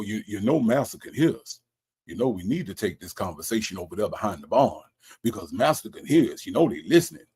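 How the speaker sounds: low, hushed conspirator; mock plantation slave. Slave warning others that master can hear, mock secretive. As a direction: keep it low and secretive, in character.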